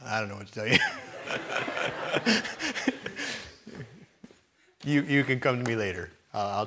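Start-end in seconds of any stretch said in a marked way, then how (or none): 0.8-3.4 breathy laugh up high; sheepish laugh after dodging the question; high airy chuckle. Real, not acted. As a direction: laugh off the awkward topic. Laughs at own dodge.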